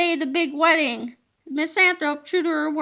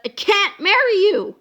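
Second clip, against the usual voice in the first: louder, blunt declaration; firm refusal; 3 semitones higher, 5 dB louder than usual. A narrator's reading. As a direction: lay it down flatly. Miss Ribble refusing.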